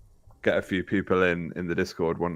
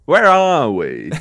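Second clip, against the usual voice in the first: loud; 8 semitones higher, 9 dB louder, much slower than usual. Pretend. Playful mock line.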